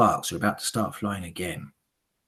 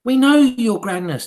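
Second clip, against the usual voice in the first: high, loud eager hag voices; high witch chorus; 10 dB louder than usual. Acted. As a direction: agree like fawning followers. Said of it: "they all answered".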